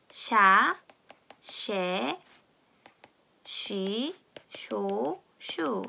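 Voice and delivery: "샤, 쉐, 쉬, 쇼, 슈" with sh, between s and ch; CH pronunciation. Shown as a demonstration. Teacher showing what CH sounds like, separate sh syllables "샤, 쉐, 쉬, 쇼, 슈". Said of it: "시옷과 치읓의 약간 중간 발음".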